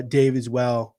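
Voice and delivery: ordinary talk, nothing marked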